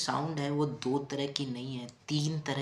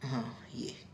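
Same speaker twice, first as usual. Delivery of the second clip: hushed off-mic aside; whispered aside; 7 dB quieter, slower than usual. Real, not acted. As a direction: whisper to yourself while fixing the board. Aside while arranging.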